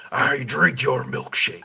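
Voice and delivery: a hint of a menacing villain; movie villain quote. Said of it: "it would make more sense for the".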